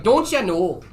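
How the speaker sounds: imitating his girlfriend's Canadian twang; Canadian accent. Telling David how his girlfriend talks, mock Canadian accent. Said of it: "they all speak like Canadians".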